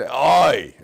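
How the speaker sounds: a drawn-out loud oy vey; loud oy vey groan. Retold or imitated. Congregant groaning at bad news with a loud oy vey. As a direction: moan it like a dismayed congregant.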